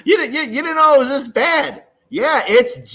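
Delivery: loud, high, laughing through it; amused teasing laugh. Real, not acted. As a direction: chuckle at their disbelief. Laughing at guest's shock.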